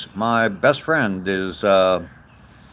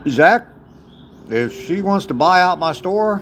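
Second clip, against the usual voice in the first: excited call-out; 9 semitones higher than usual. Real, not acted. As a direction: hail the viewer eagerly, bright and lifted. Calling to a viewer excitedly.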